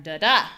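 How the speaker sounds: second da jumps up loud; weak da then strong DA; stress on second da. Shown as a demonstration. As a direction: land on the last da. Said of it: "where we have the pattern".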